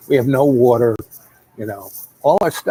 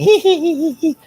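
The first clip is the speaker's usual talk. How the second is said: high, loud hee hee hee cackle; mocking high-pitched hillbilly cackle; 4 dB louder than usual. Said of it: "he starts cackling".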